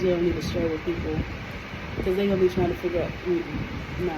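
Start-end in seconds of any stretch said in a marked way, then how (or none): none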